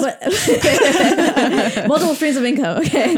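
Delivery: slow, amused and chuckling through it; laughing. Real, not acted. Laughing while owning up to her many jobs. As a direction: laugh at your own joke.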